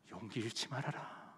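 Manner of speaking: very soft, low and slow; 낮은 속삭임 같은 격려. Acted. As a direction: encourage almost under the breath. Lord's encouragement, barely voiced. (아주 작고 느리게 다독이듯)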